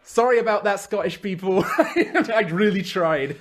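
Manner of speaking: amused, laughing through the words; slow, giggly apology. Real, not acted. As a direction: apologise while laughing at your own attempt. Laughing apology for failed accent.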